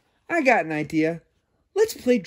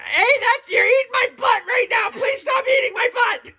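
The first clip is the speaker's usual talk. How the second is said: very high, loud crying shout; hammed-up squeal of a bitten puppy; panicked puppy yell; 4 dB louder than usual. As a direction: shriek in mock panic as he's bitten. Shark puppet bites Furball.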